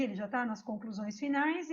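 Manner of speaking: ordinary talk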